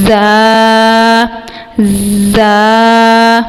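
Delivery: زا with a whistling hiss; whistling letter demo. Shown as a demonstration. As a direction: let زا whistle through the teeth. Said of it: "سیٹی کی آواز نکالتے ہوئے". (زا سیٹی کی آواز کے ساتھ)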